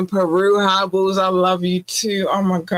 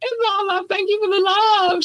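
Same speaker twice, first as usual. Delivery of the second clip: squealy gushing. Real, not acted. Thanking fans, gushing.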